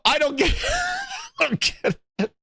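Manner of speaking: laughing at himself. Real, not acted. Cracking himself up.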